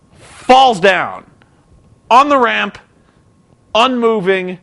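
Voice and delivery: ordinary talk, nothing marked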